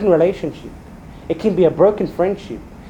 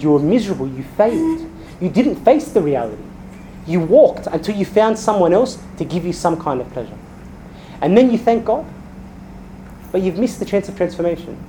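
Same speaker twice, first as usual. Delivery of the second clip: ordinary talk, nothing marked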